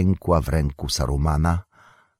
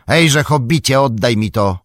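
high, loud call to the hobbit; 7 semitones higher, 6 dB louder than usual. A narrator's reading. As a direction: call sharply across the steps. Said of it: "zawołał". (głośne, nagłe zawołanie)

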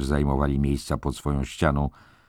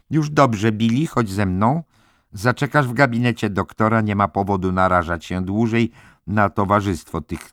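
lightly voiced matron, pitched higher; consoling head nurse; 6 semitones higher, 3 dB louder than usual. A narrator's reading. Head nurse leading Billy to the doctor's office, consoling. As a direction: soothe him firmly in a raised, higher voice.

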